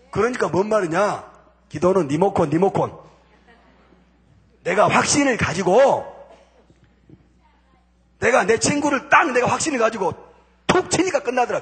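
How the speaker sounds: normal talking voice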